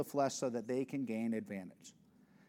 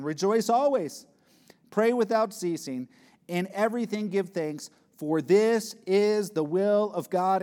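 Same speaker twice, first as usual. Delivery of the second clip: preaching at full force; 7 semitones higher, 10 dB louder than usual. Acted. Preacher proclaiming thanksgiving to the church, full force. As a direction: proclaim it like a call from the pulpit.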